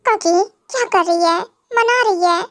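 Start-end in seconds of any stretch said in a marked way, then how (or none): none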